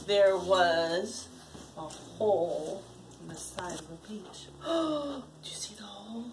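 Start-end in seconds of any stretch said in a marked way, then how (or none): 4.6-6.2 hushed question. Real, not acted. Parent asks child excitedly.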